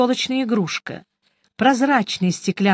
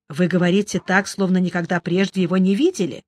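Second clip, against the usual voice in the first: plain reading voice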